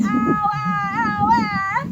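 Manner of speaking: drawn-out ows; yelling ow. A narrator's reading. Megan under the bugs yells, slow and loud. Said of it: "something was yelling".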